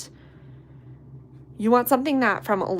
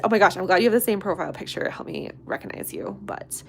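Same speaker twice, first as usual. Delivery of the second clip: ordinary delivery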